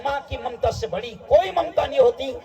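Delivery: ringing exhortation; impassioned preaching. Real, not acted. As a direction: preach it out forcefully to the crowd. Preaching at full force.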